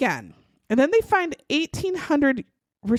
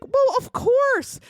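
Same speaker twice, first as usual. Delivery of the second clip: high, slow, falsely innocent "of course"; mock innocent; 10 semitones higher, slower than usual. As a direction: play the suspect sweetly unbothered. Suspect answers police innocently.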